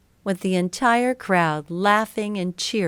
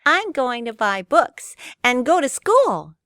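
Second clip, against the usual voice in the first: put-on higher, eager little boy; innocent puppet boy; 7 semitones higher, 3 dB louder than usual. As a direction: chirp it like a proud puppet. Pinocchio answers eagerly.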